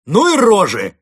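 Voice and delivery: high, loud jeer; as loud as possible. A narrator's reading. Captive Константин baiting the police, shouting loudly. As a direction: shout the insult for all to hear. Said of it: "как можно громче сказал он".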